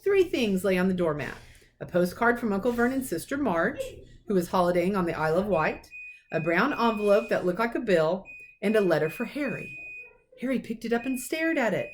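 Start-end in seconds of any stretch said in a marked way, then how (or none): none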